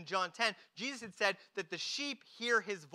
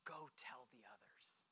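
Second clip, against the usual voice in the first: whispered commission; 8 semitones lower, 22 dB quieter than usual. Retold or imitated. Jesus sends Mary to the others, whispering. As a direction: breath-only whisper.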